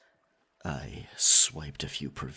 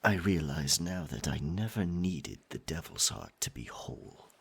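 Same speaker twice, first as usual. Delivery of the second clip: tender apology; 3 semitones lower, 6 dB quieter, faster than usual. Acted. Tender apology to a loved one.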